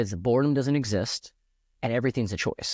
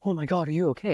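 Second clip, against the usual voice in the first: a higher, lightly hammed-up panicked parent; mock overreaction; 6 semitones higher, faster than usual. Dad showing the wrong way to react to a son's injury, mock panic. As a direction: imitate the over-the-top alarmed parent, half-joking. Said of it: "our goal is to not overreact with him and be like".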